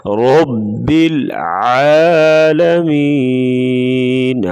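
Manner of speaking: "رب العالمین" chanted with heavy ر and throat ع; full ra, throaty ain. Shown as a demonstration. Teacher demonstrates for learners, full ر and clear ع in "رب العالمین". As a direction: thicken ر in "رب", long held "العالمین". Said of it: "را کو پر پڑھیں گے موٹا".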